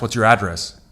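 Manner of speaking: "address" said as AD-dress; stress on first syllable. Shown as a demonstration. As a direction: punch the AD in "address". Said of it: "But if I say address".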